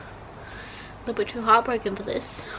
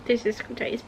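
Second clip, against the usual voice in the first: ordinary talk, nothing marked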